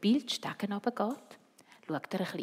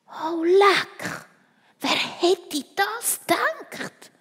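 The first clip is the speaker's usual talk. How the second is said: high, loud, slow amazement; astonished; 12 semitones higher, 12 dB louder, much slower than usual. Acted. Malvine reacts to the secret, astonished, high and loud. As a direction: gasp at the news.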